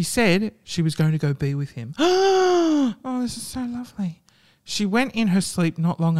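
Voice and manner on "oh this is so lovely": touched gasp. Real, not acted. Touched by the ending.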